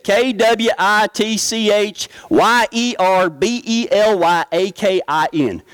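spelling letter by letter, slowly; spelled out. Shown as a demonstration. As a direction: spell each letter out, slow and clear. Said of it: "that's what it said".

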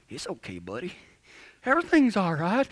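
hushed, soothing cowboy patter; smooth-talking cowboy. Retold or imitated. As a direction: softly sweet-talk a nervous horse like a cowboy. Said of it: "hear my smooth-talking cowboy".

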